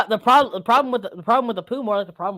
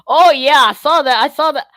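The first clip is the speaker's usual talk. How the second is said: chatty Midwesterner, pitched up; higher, hammed-up Midwestern voice; Midwestern accent; 3 semitones higher, 4 dB louder than usual. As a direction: put on a folksy Midwestern accent. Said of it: "like, Midwestern Venom".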